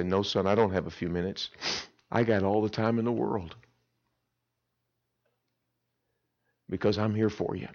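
low and soft, tender; reassuring father. Retold or imitated. Father tenderly reassures his troubled son on the phone. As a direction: gently promise all the time in the world.

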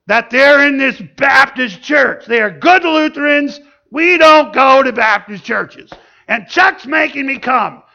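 high, loud mock complaint; indignant, unhappy wife. Lutheran wife dragged to a Baptist church, indignant complaint. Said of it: "she is not happy".

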